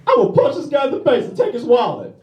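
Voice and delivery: high, put-on thug voice; mock attacker voice. Voicing the attacker's plan.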